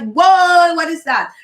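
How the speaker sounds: loud, high "whoa" of alarm; mock-startled outburst, easing off on the question; acted startled shock. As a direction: jump out of your skin, playfully. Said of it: "jump out of your skin like".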